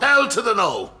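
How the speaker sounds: high, loud, final no; father flatly refusing his son, loud emphatic no; emphatic loud refusal. Acted. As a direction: shut it down, loud and absolute.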